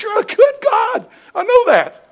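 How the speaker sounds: high, angry shout at God; mad at God. Retold or imitated. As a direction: cry out in mock fury. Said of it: "He's really mad at God".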